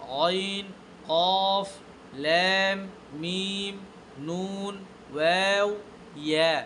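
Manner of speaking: Arabic letters recited one by one, "জিম" and "আইন" long drawn out; careful letter articulation. Shown as a demonstration. Teacher articulating the jahr letters from "আলিফ" to "ইয়া" for students, slow careful recitation. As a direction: draw out each letter name from "আলিফ" to "ইয়া", pausing after each. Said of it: "আমি আদায় করলাম, পড়লাম".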